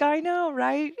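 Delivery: high, loud reenacted agreement; excited stranger. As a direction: imitate a thrilled fan agreeing. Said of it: "she was all excited".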